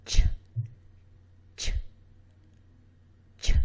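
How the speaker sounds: crisp ch bursts; ch sound. Shown as a demonstration. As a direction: pop ch off the roof of the mouth. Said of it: "This sound is made by using the middle".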